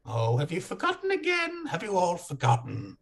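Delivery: high sing-song jester taunt; mocking jester. Harlequin taunts the forgetful party, high sing-song mockery. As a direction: tease them like a gleeful madman.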